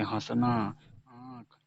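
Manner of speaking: first vowel of हंसना and आँख through nose and mouth together; nasalised vowels. Shown as a demonstration. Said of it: "उच्चारण नासिका और मुख दोनों से".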